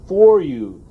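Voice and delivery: "for you" repeated, leaning hard on "for"; stress on for you. Shown as a demonstration. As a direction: press the words "for you" home. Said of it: "And I stress that".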